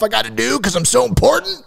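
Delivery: sarcastic boast about his own importance. Acted. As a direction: puff it up sarcastically. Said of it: "saying that very sarcastically".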